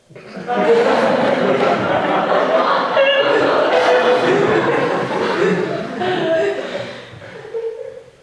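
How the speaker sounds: very high, long, loud giggling; giggling fit. Real, not acted. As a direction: lose it completely.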